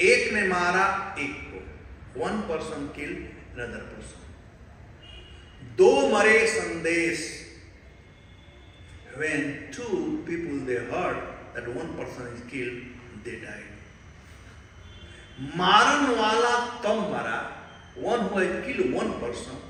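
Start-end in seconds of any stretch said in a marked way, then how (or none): 0.1-1.6 riddle recitation. Shown as a demonstration. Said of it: "I'll translate in Hindi". 5.8-7.3 riddle recitation. Shown as a demonstration. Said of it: "I'll translate in Hindi". 15.4-17.4 riddle recitation. Shown as a demonstration. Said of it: "I'll translate in Hindi".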